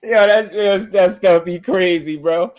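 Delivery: real high-pitched, winded laugh-talk; crying with laughter. Real, not acted. Telling fans they're crazy, crying with laughter. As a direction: catch your breath after laughing hard. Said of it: "y'all be having me crying".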